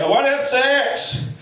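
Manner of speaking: put-on drunk urge. Drunk urge voiced in routine.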